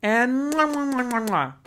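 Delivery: mock a girl pouting for the camera; hammed-up pouty selfie girl with kiss sounds; duck-lips selfie girl. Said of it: "Remember when duck lips were the craze?".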